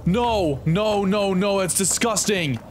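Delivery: high-pitched, grossed-out protest; real squeamish alarm; revolted panic. Real, not acted. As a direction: recoil in disgust, pitch climbing. Recoils from disgusting creatures.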